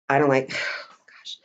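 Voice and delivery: slow reluctant whisper with a sigh; whispered dread. Real, not acted. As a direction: sigh and whisper your dislike. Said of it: "I do not like this next sentence".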